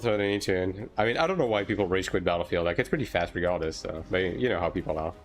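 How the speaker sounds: usual speaking voice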